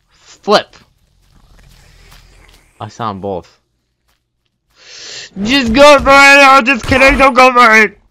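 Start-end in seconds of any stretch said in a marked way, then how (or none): 0.1-0.7 loud, high cry; sharp exclamation. Real, not acted. Gamer yelping at a sudden setback, loud and high. 5.4-8.0 loud, high shout; yelling. Real, not acted. Gamer yelling orders to himself, loud and high.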